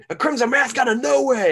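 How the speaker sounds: high, loud commentator cry; J R impression. Podcaster mimics commentator's call of a bloodied wrestler, high and loud. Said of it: "It's a J R special".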